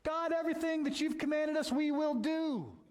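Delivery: high, loud, earnest promise of the people; eager vow of the people. Retold or imitated. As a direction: play the people vowing with all their heart. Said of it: "what did they say?".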